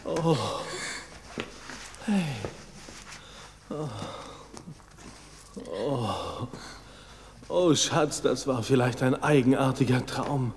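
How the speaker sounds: very soft, low, loving; father murmuring gently to his daughter; tender whisper. Acted. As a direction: hold her close and speak softly. Father holds daughter after waking.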